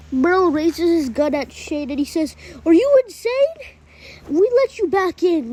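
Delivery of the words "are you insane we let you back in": high, loud disbelieving challenge; outraged accusation. A narrator's reading. Merle raising his gun at Shane, outraged accusation. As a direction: confront a traitor in disbelief.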